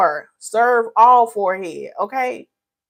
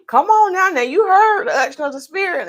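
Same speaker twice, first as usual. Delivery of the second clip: excited preaching; 9 semitones higher than usual. Real, not acted. Preacher-style praise.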